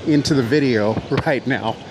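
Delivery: out of breath from walking; puffing after a long walk; winded. Real, not acted. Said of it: "I'm winded".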